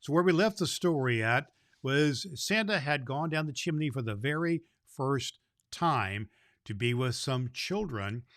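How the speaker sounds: usual speaking voice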